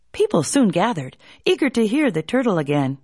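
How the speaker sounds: plain reading voice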